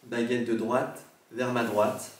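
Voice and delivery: slow, careful questions to a spirit; solemn séance questions. Acted. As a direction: speak slowly to the dead.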